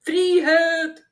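high, loud howl. A narrator's reading. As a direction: howl it out like a zealot. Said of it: "hylte Tyttebær".

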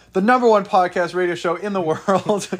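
loud, high hype announcer; ringmaster. Acted. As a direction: shout it like a circus barker.

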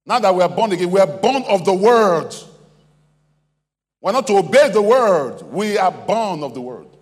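loud, high proclamation; ringing sermon climax; full-force preaching. Real, not acted. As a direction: proclaim it from the pulpit, drawing out key words.

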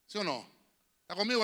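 normal talking voice

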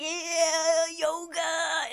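high, drawn-out singsong cheer; mock enthusiasm. Vlogger imitating last week's yoga excitement, mock enthusiasm. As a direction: sing it out long and high, hammed-up.

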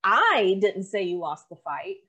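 I punched loud, the rest trailing after; stretched, emphatic I at the start; stress on I. Shown as a demonstration. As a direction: lean hard and long on the opening I. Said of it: "based off of the inflection".

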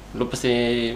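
usual speaking voice

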